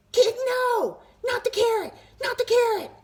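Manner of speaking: very high, loud terrified cry; shrieking panic. A narrator's reading. Tooth begging the boy to spare it, shrieking. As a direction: scream in terror at the carrot.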